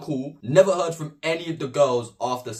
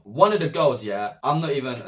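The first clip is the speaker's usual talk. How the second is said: ordinary delivery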